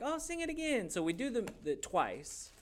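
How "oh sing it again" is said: high, eager mock request; imitated delighted audience; excited crowd. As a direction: gush it in a high voice. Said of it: "everyone's excited and like".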